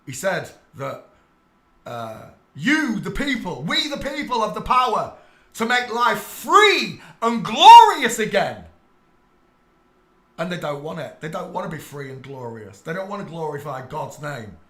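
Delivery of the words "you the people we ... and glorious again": a hint of a rousing speech, higher and louder; Chaplin's speech. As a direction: declaim it like a film speech, slowing. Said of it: "Charlie Chaplin said it in The Dictator".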